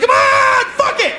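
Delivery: high, loud mock yell; demanding yell. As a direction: shout at him to keep going. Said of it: "She's like".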